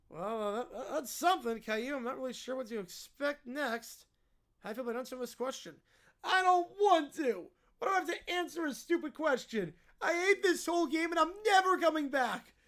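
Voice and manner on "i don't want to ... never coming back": put-on petulant child refusing; bratty mock-tantrum voice; whiny kid. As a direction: whine it like a spoiled brat. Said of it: "peak whiny kid territory".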